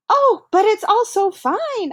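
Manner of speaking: high, delighted exclamation. Acted. Keck answering Hannah about the cafeteria food, high and delighted. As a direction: marvel at the food.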